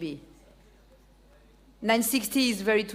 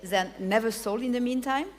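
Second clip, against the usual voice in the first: normal talking voice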